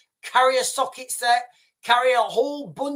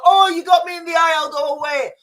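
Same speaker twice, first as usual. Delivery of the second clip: mock attacker; 8 semitones higher, 6 dB louder than usual.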